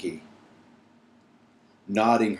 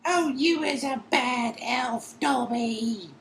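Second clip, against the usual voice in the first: very high, slow, moaning elf voice; slower than usual. Acted. Winky moaning at Dobby in a high elf voice. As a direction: moan it in a tearful elf squeak. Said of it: "moaned Winky".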